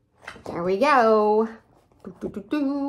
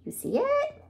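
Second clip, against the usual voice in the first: high-pitched question; 3 dB quieter than usual. Real, not acted. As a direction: ask it way up high, teasing. Asking the child listener.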